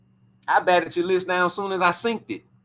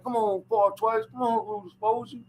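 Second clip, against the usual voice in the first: lightly voiced pleading dad, a bit higher; mock Bill begging for belts; Bill Haney impression; 4 semitones higher than usual. Bill on the phone pushing for the belts, lightly imitated. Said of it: "He be like".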